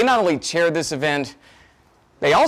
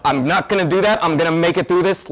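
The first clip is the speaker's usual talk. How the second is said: ordinary talk, nothing marked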